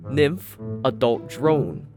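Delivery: plain reading voice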